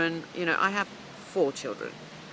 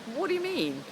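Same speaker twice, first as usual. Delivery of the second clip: incredulous; 9 semitones higher, 3 dB quieter than usual. Retold or imitated. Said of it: "I'm like".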